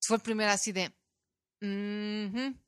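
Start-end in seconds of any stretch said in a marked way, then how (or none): 1.6-2.6 curt brush-off. Retold or imitated. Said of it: "el cortón mala onda".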